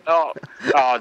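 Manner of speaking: ordinary talk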